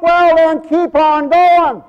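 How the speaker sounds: high, loud, drawn-out preacher's shout; shouted exhortation. Real, not acted. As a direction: holler it slowly to the whole congregation. Shouted repeat of soft exhortation.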